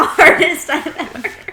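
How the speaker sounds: normal talking voice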